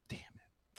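a breathy whispered curse. Real, not acted. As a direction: whisper it under your breath. Doubts his answer, mutters.

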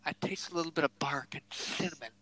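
a hint of a fussy old whiskey taster, whispered; mocking whiskey taster. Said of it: "these old guys".

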